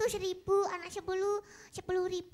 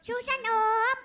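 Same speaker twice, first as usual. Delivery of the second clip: louder for someone who missed it; to someone hard of hearing; 7 dB louder, much slower than usual. Shown as a demonstration. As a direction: repeat it louder so Om hears. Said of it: "Siapa nggak dengar?". (lebih keras untuk orang yang kurang dengar)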